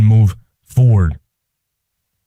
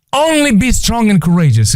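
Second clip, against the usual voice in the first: high-pitched, insistent divine command; God humorously insisting; emphatic repeat; 9 semitones higher than usual. Acted. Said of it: "I just want to emphasize".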